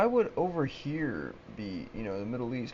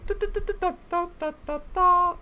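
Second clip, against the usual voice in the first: very high sing-song nonsense syllables, a bit louder; mock foreign babble; 5 dB louder than usual. Ranting vlogger mocks East Asians with very high nonsense syllables. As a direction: squeak out mock gibberish.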